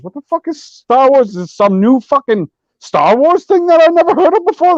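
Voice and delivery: incredulous outburst. Retold or imitated. Retelling his confused reaction to a fan, incredulous outburst. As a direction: blurt it out like Star Wars just got a strange new title. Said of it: "I was like".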